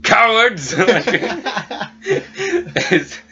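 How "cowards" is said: loud, high holler; mock-defiant yell; shouted taunt. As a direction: hurl it through the door, half laughing.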